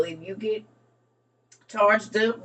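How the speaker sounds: usual speaking voice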